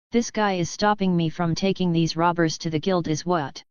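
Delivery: plain reading voice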